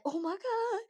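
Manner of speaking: high, sudden pained gasp of words; mock pained exclamation. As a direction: react like you just got burned. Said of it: "And you like".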